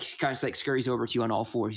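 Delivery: ordinary talk, nothing marked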